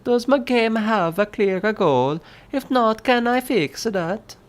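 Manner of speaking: high, hammed-up Italian accent. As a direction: read it like a comic Italian chef, Italian accent. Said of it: "Do an Italiano accent spaghetti".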